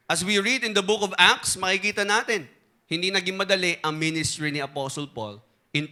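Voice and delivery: firm pulpit preaching. Acted. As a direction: preach it to the congregation. Preaching the sermon point.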